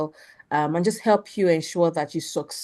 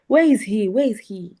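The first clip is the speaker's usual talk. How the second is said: calling out; 7 dB louder than usual. Real, not acted. Host calling out for the winner.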